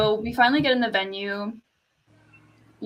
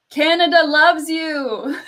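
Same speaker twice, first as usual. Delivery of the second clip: drawn-out, high, loud crowd shout; retold yell; 6 semitones higher, 6 dB louder, slower than usual. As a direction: re-enact yelling out from the audience. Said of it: "I yelled".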